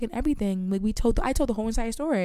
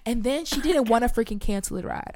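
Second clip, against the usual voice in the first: ordinary talk, nothing marked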